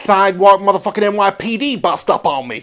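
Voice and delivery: hammed-up New York tough guy; New York accent. Complaining about police over coffee, New York accent. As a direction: ham up the New York vowels. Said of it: "New York City".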